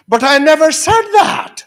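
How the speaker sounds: high, very loud mock outcry; indignant protest. Jennifer getting up to deny it, loud indignant protest. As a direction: act out a shocked student denying it.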